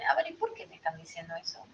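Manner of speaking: soft, quick musing to oneself; unoffended self-questioning. Acted. Person turning over what several people said, quietly self-questioning. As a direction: ask yourself quietly, taking no offence. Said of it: "No es para ofenderse".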